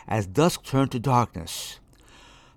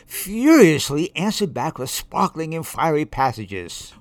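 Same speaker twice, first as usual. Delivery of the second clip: ordinary delivery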